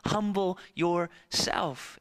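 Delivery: Humble yourself stretched out slowly, each word weighed; slow earnest urging to the king; slow, drawn-out plea. Acted. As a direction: let the second Humble yourself land slowly and gravely. Repeats advice, drawn out.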